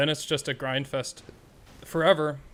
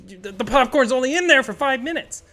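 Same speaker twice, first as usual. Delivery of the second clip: high, loud, fed-up scolding; mock outraged explanation; exasperated retort; 10 semitones higher, 9 dB louder than usual. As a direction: lecture the idiots in disbelief. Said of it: "And it's like".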